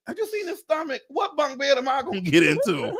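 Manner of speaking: high-pitched incredulous question; comic disbelief. Acted. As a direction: protest the absurd offer, breaking toward a laugh. Retold disbelief at bunk beds.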